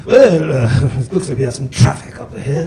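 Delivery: lightly put-on British butler voice; a GPS voice, prim and polite, then crude; hint of a British butler GPS. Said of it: "this British butler voice".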